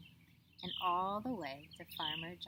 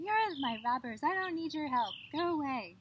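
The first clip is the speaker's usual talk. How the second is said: mild shout; 7 semitones higher, 4 dB louder than usual. Acted. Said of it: "shouting at them".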